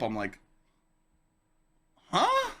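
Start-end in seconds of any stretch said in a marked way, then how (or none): 2.1-2.5 a very high, louder startled huh; jolted awake and confused; high yelp of confusion at the alarm; groggy startled huh. Retold or imitated. Woken by the alarm.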